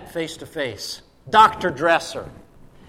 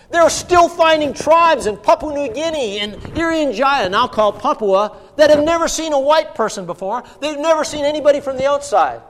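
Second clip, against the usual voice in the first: high, loud, amazed preaching; emphatic preaching; 8 semitones higher, 4 dB louder than usual. Real, not acted. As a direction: press home how incredible this is. Preacher marvels at unreached tribes.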